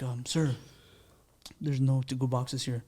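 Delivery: imitated waiter, soft and low; low-talking waiter. Waiter refusing a customer a to-go box, low and soft. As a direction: break it discreetly in a hushed low voice. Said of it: "he's talking like that, low".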